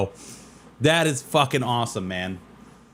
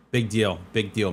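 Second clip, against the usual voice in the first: ordinary delivery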